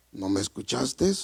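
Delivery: low, soft divine reproach; voice of God. Retold or imitated. Preacher voices God asking whether he heard, low soft voice. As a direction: question him deep and hushed, as God. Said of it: "así yo escuché la voz de Dios".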